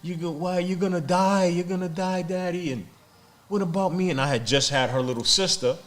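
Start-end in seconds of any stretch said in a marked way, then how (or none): 0.0-4.1 imitated tearful crying. Daughter pleading with her stabbed father, imitated crying. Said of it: "crying profusely".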